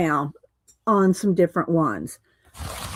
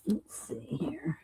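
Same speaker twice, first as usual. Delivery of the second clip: whispered to herself; 3 semitones lower, 15 dB quieter, slower than usual. Real, not acted. Crafter muttering while searching paints.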